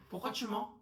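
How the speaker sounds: ordinary delivery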